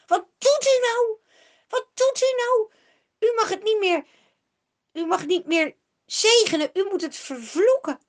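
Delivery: very high, loud, frantic pleading; a despairing king crying out; desperate. A narrator's reading. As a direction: beg in panic. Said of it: "Balak wordt helemaal wanhopig".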